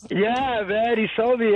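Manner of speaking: usual speaking voice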